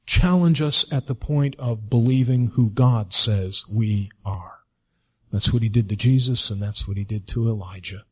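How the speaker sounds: normal talking voice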